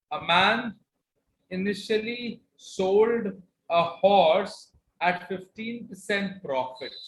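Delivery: slow, careful reread for a student; slow repeat. Shown as a demonstration. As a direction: spell out the question slowly. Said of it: "could you please repeat this question".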